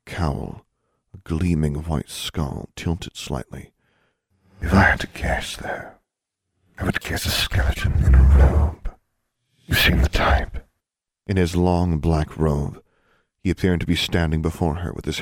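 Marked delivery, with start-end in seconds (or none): 4.6-10.7 grim reaper voice. A narrator's reading. Death speaks.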